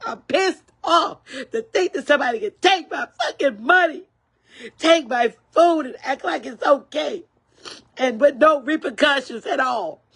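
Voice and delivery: high, heated ranting with bursts of laughter; really mad, half laughing as she vents; angry rant. Real, not acted. Angry senior venting to her live viewers about stolen food money. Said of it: "I'm just that mad".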